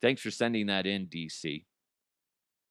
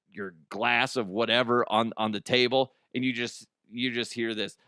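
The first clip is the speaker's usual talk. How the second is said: normal talking voice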